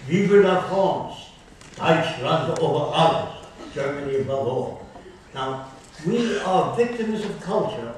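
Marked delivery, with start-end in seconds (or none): 0.1-3.5 lightly put-on French then German, loud and raised; mock patriotic cry. Lecturer mimics patriots to show culture shapes speech, loud mock cry. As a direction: proclaim it like a proud local, a hint of accent. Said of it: "the way you would speak if you were brought up there".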